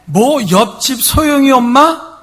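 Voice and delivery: high, loud, jealous outburst; screaming. Retold or imitated. 옆집 여자를 두고 남편에게 소리치는 아내. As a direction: scream at the cheating husband. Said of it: "죽을 듯이 막 외치는".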